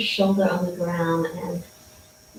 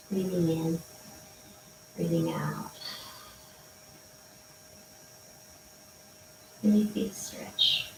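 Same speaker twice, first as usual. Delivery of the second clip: right in your ear; 11 dB quieter than usual. Real, not acted. Soft breathing cues in stretch.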